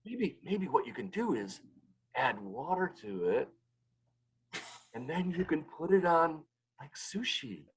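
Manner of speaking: lightly voiced sly suggestion; wry wife.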